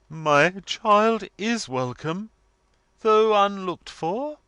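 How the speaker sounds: loud, high and slow, grandly cold; stately, ceremonious mother. A narrator's reading. Missus Wilfer receives her unexpected daughter, ceremonious gloom. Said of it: "received them with ghostly gloom".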